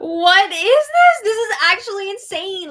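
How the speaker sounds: high, loud, drawn-out incredulous cry; outraged disbelief. Real, not acted. Host reacting to two-faced comforting, outraged disbelief. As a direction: burst out high, then ease off.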